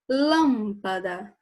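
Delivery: "Lâmpada" slow, with LÂM pushed and held; stress on the first syllable. Shown as a demonstration. Teacher demonstrating the tonic syllable, LÂM drawn out in "Lâmpada". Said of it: "é o lam".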